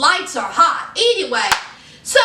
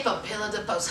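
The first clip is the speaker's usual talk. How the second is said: hushed dramatic repeat; 7 semitones lower, 11 dB quieter, faster than usual. Retold or imitated. Gossiping host repeating the line, hushed and low. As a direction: repeat it softer, trailing down. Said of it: "she said".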